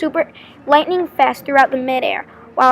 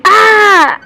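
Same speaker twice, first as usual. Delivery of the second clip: loud, high, held shriek; drawn-out shriek of dismay; 7 semitones higher, 10 dB louder than usual. A narrator's reading. Girl with batter spilled on her favorite shirt, shrieking. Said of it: "I shriek".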